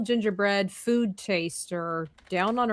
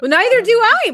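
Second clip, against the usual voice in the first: very high, loud squeal of protest; playful protest; 12 dB louder, much faster than usual. Pretend. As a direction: shoot the voice way up in mock exasperation. Playful protest about the gingerbread task.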